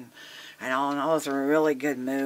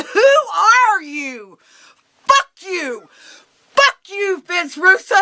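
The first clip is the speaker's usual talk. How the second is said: loud, high-pitched yelling; indignant, explosive shouting; furious outburst; 8 dB louder, slower than usual. Retold or imitated. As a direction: tear into him at the top of your voice. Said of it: "I just blew up".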